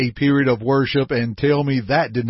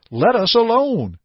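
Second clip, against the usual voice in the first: raised cry quoted from the possessed man; mock demon cry; 9 semitones higher than usual. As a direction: cry it out like the spirit, lightly. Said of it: "would never cry out".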